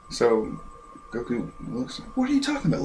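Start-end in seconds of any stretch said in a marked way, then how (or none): 2.1-2.8 high, light put-on voice; mock puzzled hero. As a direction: ask it in a squeaky, clueless voice.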